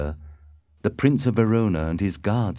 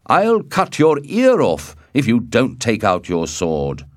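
loud, raised-pitch threat; crying out a threat; 5 semitones higher, 7 dB louder than usual. A narrator's reading. Mercutio goads Tybalt to draw, in a loud threatening cry. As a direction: threaten him loudly. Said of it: "Mercutio cried".

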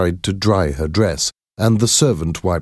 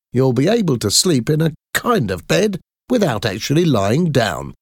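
higher, eager inventor voice; proud engineer Taylor; 5 semitones higher than usual. A narrator's reading. Taylor showing Merrick the special chair, higher eager pride. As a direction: show off the chair you built for him, higher.

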